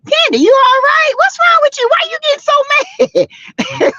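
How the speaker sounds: high mocking imitation of Marlo; mock Marlo taunt. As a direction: needle her in a high sing-song. Mocking Marlo teasing Candy.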